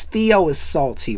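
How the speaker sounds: ordinary talk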